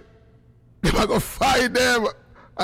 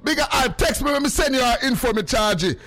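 calling out; 5 semitones higher than usual. Acted. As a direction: shout it out like a radio hype man. Calling out to Bigger Hype.

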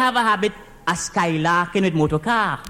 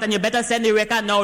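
ordinary talk

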